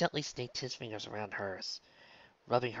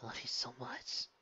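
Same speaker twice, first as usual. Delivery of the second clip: whisper; 2 semitones higher, 8 dB quieter than usual. A narrator's reading. Whispered reassurance in embrace.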